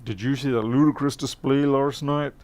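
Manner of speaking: hammed-up Cockney small talk; put-on Cockney. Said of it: "I'm gonna get Cockney neck".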